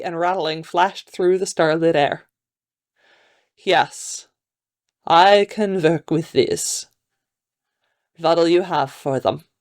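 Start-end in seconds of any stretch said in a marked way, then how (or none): 3.6-9.5 put-on slow, measured Hungarian accent. A narrator's reading. Man inspecting the bones he received, slow Hungarian accent. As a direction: speak as a deliberate Hungarian buyer. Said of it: "deep masculine voice with a Hungarian accent".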